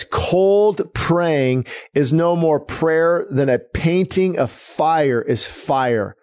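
slow, weighty reading of the quote; solemn quote read at half speed; slow emphatic quote. Real, not acted. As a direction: let each word land, slowly. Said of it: "Just, just absorb that for a minute".

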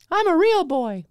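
put-on very high falsetto declaring he is a real boy; falsetto Pinocchio. Host joking about his own high voice, in a falsetto. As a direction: squeak it like a puppet come to life.

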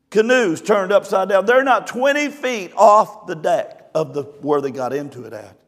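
ordinary delivery

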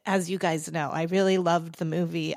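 ordinary talk

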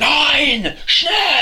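high, loud mock-German shouting; screamed angry German. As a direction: bark orders in a hammed-up German accent. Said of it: "You just scream at everyone".

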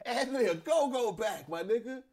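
high excited shout; hype. Real, not acted. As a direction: call it out hyped. Hype again.

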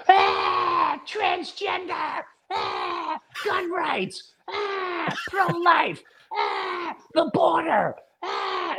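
high-pitched hammed-up alarm cries; mock panic. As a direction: squeal each scare topic like a fake emergency. Mimics alarmist media distraction bombs.

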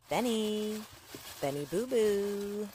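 high, drawn-out pet-name call; teasing lilt, held final notes; sing-song calling. Acted. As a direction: coo to get his attention. Wife calls to ignoring husband.

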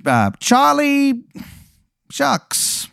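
high, slow wiseguy drawl; old-timey gangster introducing himself; 1920s gangster. Acted. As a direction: cactus gangster saying his name. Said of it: "He goes".

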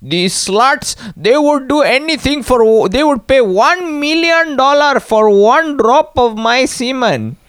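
put-on high, loud, dragged-out Indian accent; imitating Indian guru. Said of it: "he says things like".

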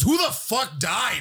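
high, slow mock holler; appalled outcry. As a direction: stretch it out in hammed-up disgust. Bachelor's retold outcry at smell.

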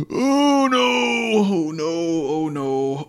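high cry of dismay, fading; panicked wail. Acted. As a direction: cry out in horror then sink. Reacting to shots heard.

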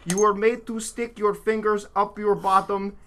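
broken Russian man, deliberate mock accent; sad Russian accent. Host reading a survivor's account as asked, sad Russian accent. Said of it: "say it like a sad, broken Russian man".

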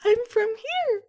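high, quavering little-kid voice, hammed-up tears; crying scared child. Captured child begging a stranger for mercy, scared crying child voice. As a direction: plead like a frightened small child, very high-pitched. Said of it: "he's crying".

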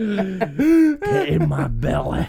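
slow, high Scottish Fat Bastard voice; Scottish accent. Retold or imitated. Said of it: "best Scottish accents I've ever done".

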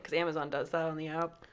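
ordinary talk

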